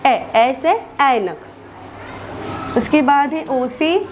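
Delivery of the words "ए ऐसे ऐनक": ए and ऐनक drawn out slowly with an open ɛ; slow model vowel. Shown as a demonstration. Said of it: "इस ए का उच्चारण होता है".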